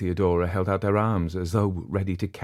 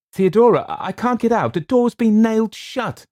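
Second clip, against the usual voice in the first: much higher and louder, close to crying out; panicked; 10 dB louder than usual. Acted. Trapped Eleanor calls down to Theodora in panic. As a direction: panicky cry of someone trapped.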